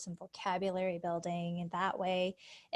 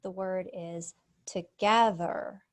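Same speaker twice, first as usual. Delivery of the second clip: slow, clear "together" with stress on "ge"; careful dictation; 5 dB louder, slower than usual. Shown as a demonstration. Teacher dictating a word to a student, slow and clear. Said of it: "Listen for the vowel in the stressed syllable".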